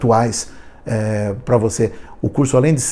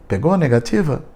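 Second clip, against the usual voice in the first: normal talking voice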